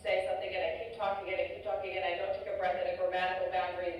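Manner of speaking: fast, no pauses for breath; rushed run-on speech. Shown as a demonstration. Demonstrating cluttering for students, fast run-on speech. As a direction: keep talking without stopping to breathe. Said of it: "I'm not very good at simulating this, but I will try".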